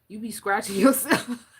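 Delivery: cracking up mid-sentence; words breaking into a real laugh. Real, not acted. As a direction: laugh through "yourself". Laughing at scratching in dreams.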